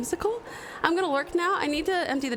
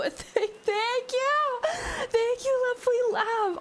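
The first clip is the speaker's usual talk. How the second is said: teary laugh-crying; 9 semitones higher, slower than usual. Real, not acted. Overwhelmed by a huge donation.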